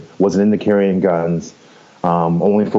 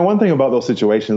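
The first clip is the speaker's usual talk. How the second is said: usual speaking voice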